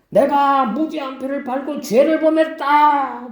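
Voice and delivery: high, slow, drawn-out cry of guilt; Judas crying out. Retold or imitated. Judas cries his guilt to the priests, high and slow. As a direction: call it out like a man confessing aloud. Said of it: "막 외치는 거예요".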